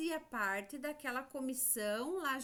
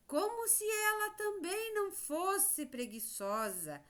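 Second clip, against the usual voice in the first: high-pitched, lightly mocking quote; story aside; 8 semitones higher than usual. Teacher quoting the story's remark about the cicada, high pointed aside. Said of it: "Ainda dizia assim".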